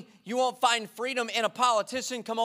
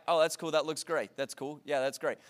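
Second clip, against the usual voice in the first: low, quiet, half-hearted oh that's cool; bored agreeing; 7 semitones lower, 5 dB quieter than usual. Retold or imitated. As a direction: mumble along without caring. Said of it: "And it was like".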